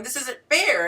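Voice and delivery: mock aggrieved objection; upset complaint. Man in the comments objecting to her advice, mock upset. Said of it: "he was upset, going".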